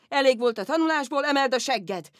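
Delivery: loud, fast booming 'emeld a segged'; father's shout. Shown as a demonstration. Daughter showing how her father yelled it, loud and fast. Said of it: "Ilyen volt".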